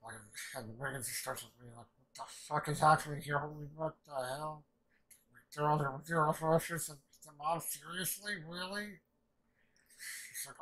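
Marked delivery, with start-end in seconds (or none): 1.9-9.0 high-pitched, incredulous mock outburst; retold freak-out. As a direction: react in loud disbelief, climbing higher. Character panics in a retold scene.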